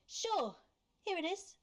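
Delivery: high-pitched, meek giving-in; mock pushover. Mocks Alex giving in meekly.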